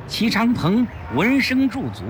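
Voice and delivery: plain reading voice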